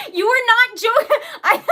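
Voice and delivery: loud, high, laughing disbelief. Real, not acted. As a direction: laugh out in shock. Donation was real.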